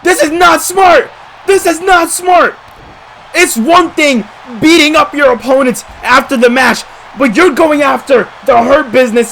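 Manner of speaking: shouting. Real, not acted. Outraged at the post-match attack.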